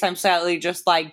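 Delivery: usual speaking voice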